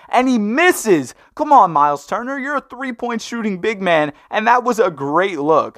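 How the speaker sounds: exasperated at Myles Turner for bricking it; frustrated. Real, not acted. Gamer groans at his player for missing the open three.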